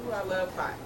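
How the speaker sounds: ordinary talk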